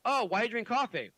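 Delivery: ordinary delivery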